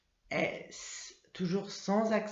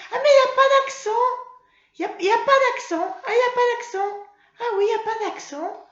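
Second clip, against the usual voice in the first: put-on much higher, louder and fast: ah, no accent!; excited realisation; 10 dB louder, faster than usual. Puppet Mimi eagerly telling teacher, loud high exclamation. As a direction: burst out with the discovery.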